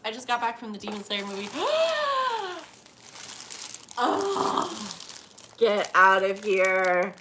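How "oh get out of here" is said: loud, slow get out of here; excited disbelief. Real, not acted. Amazed by her friend's gift, loud slow happy disbelief.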